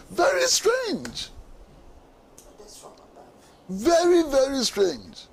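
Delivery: incredulous. Real, not acted. Indignant panelist on commission ruling.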